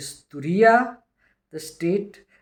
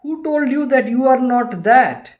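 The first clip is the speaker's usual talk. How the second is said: emphatic retort; 7 semitones higher, 6 dB louder than usual. Retold or imitated. Sage retorts to king.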